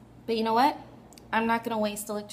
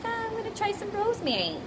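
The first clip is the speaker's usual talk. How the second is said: high voice; 11 semitones higher than usual. Retold or imitated. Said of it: "I was like".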